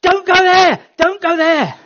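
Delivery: high, loud urgent warning; frantic warning call; urgent warning shout. Shown as a demonstration. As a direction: shout it to stop someone in danger. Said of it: "Or a bit like us saying".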